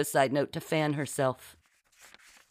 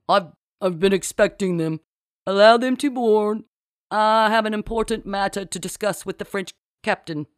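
loud, pompous put-on authority; fake captain; 6 semitones higher, 5 dB louder than usual. A narrator's reading. Marcy orders crewman to allow boarding, mock-authoritative. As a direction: give orders in a big, bluffing voice.

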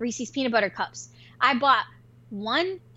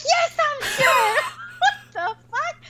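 very high, indignant squeal breaking into laughter; mock-outraged high yell; retold furious outburst; 3 dB louder, slower than usual. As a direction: snap back, shrill and exasperated. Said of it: "I got so mad".

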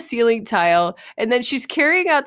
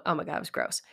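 whispered, much softer, grossed out; disgusted whisper; 4 semitones lower, 11 dB quieter, faster than usual. Real, not acted. Telling viewers about the gross mess, whispered disgust.

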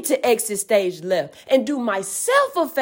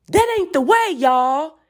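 normal talking voice

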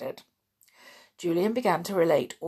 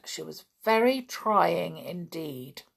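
ordinary delivery, nothing marked

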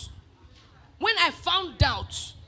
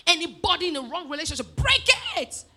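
retold fervent prayer; 5 dB louder than usual. Young pastor praying against wrong relationships, building to a shouted command. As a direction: re-enact a prayer, exploding on "break it". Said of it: "I started praying".

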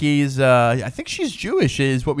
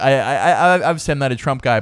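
normal talking voice

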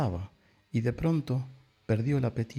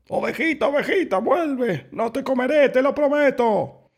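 very high, loud calling; desperate shouting; 14 semitones higher, 6 dB louder than usual. A narrator's reading. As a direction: cry out pleading into a forest. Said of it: "hacia el oscuro bosque gritando". (gritos agudos de súplica)

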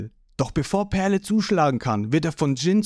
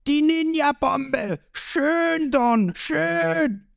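high, drawn-out adoring cheer; 13 semitones higher, much slower than usual. A narrator's reading. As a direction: whoop for your boss. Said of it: "Creeks Bande jubeln ihren Boss wieder zu".

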